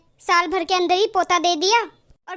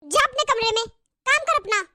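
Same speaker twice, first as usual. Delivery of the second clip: higher, sharp scolding mother-in-law; harsh scolding; 4 semitones higher than usual. Acted. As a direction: warn her off the baby, spitting the words. Mother-in-law scolds the childless elder daughter-in-law.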